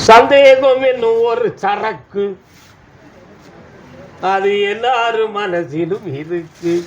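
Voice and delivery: slow, loud declaration of a maxim; solemn pronouncement. Acted. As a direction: proclaim it like divine wisdom. Oracle pronounces a proverb.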